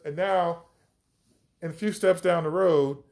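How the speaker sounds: usual speaking voice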